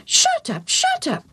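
very high parrot squawk. A narrator's reading. Parrot interrupting as it leaves, squawking. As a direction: squawk it like a cheeky bird.

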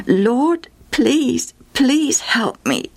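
high, slow, imploring appeal; pleading. A narrator's reading. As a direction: beg earnestly, stretching the plea. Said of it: "she pleaded".

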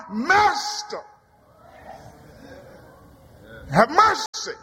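high call of Master; desperate prayer; pleading cry. Acted. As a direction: reach out in need. Prayer when no way out.

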